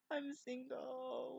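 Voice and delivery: a drawn-out squeaky boast; mock high drawl. Mock self-announcement.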